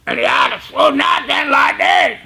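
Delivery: high rasping imitation of an inmate; Lauderdale's raspy voice. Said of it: "his voice reared out like this".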